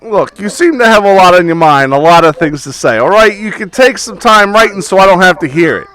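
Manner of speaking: lightly voiced eager bookstore owner; pushy shopkeeper. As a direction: welcome the customer like a pushy shopkeeper. GM voices Tony.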